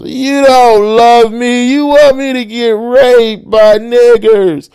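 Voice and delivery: very high, loud, drawn-out mock wail; slow, piercing imitation of drunken whining; drunken wailing whine. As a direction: screech it like a hammed-up hysterical drunk. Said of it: "she was whining".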